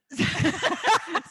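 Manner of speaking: loud burst of laughter; bright outburst of laughter. Real, not acted. Laughs at lunch story.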